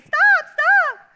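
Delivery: put-on very high, loud child's yell; yelling stop. Pastor picturing himself as little Bobby, high shout. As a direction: shout it high like a scrawny kid. Pastor voicing kid again.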